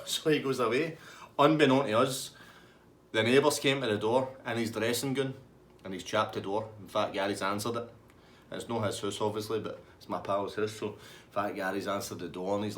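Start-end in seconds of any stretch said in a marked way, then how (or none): none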